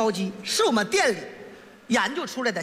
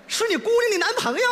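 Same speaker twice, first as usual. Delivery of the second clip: higher, incredulous echo; surprised question; 6 semitones higher than usual. Pretend. Fake customer hears it is the manager's daughter's boyfriend, higher and surprised. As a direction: check the news in mild astonishment.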